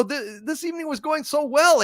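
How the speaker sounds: high, wistful complaint; mock lament. [name] complaining to a viewer that the night went wrong, high and wistful.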